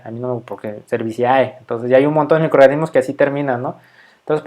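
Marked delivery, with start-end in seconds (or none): 0.9-1.6 Cerevisiae with I, A and E each sounded; spelled-out pronunciation. Shown as a demonstration. Teacher repeating the disliked form, Cerevisiae ending in "si-A-E". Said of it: "sí se ve medio feo".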